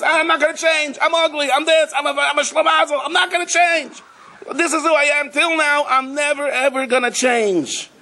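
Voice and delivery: high, whiny, stubborn wail; stubborn schlemazel. Acted. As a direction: whine it like a hopeless schlemazel who refuses to budge.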